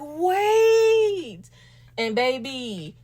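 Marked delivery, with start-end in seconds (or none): 0.0-1.5 one long, high, stretched-out wait; retold stunned wait. Vlogger retelling why she flatlined, stunned. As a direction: act out a drawn-out double take. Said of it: "'cause I was like".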